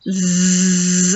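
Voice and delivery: low buzz through closed teeth; modelling the zzz; zzz sound. Shown as a demonstration. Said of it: "get your teeth together".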